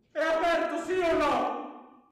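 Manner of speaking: impatient insisting. Acted. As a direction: press for an answer, exasperated. Insisting at closed door.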